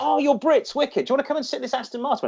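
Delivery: high, fast eager salesman. Retold or imitated. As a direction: pitch it up like a keen car dealer. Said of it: "And the guy's like".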